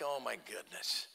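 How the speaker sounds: low, soft, slowed groan of dismay; dismayed sigh. Retold or imitated. Hunter to himself on finding a stranger in his stand, low soft dismay. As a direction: mutter it like your hunt just got ruined. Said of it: "And I'm like".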